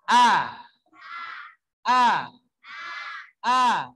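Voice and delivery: loud wide-open A; open-mouthed A. Shown as a demonstration. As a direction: drop the jaw and sing out A. (A dengan mulut terbuka lebar)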